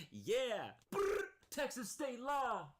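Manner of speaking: high, loud, slow cheering mockery; mock hype sidekick. Streamer mocking the sidekick cheering grandma on, shrill. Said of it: "She's behind there like".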